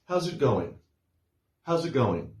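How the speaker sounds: connected, contracted "How's it going?"; casual run-together greeting. Shown as a demonstration. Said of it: "it is written, "How is it going?".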